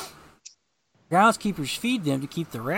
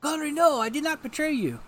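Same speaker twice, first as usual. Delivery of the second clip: desperate denial; 9 semitones higher than usual. Acted. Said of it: "he's Kirbying all over the place".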